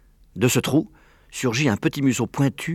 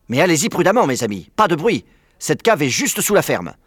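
raised, urgent warning; higher, pressing caution; 7 semitones higher, 5 dB louder than usual. A narrator's reading. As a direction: urge your friends to be careful. Fox warns his friends.

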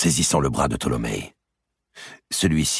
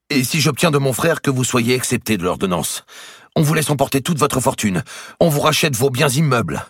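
higher, louder urgent prelate; pleading archbishop; 11 semitones higher, 6 dB louder than usual. A narrator's reading. The archbishop bargains with the merchant, high and urgent. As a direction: press the offer hard, higher.

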